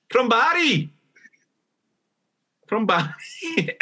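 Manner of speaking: high, lilting Welsh voice; Welsh accent. Pretend. Showing off her Welsh accent to her co-host. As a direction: put on a sing-song Welsh accent. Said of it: "I'm doing my Welsh".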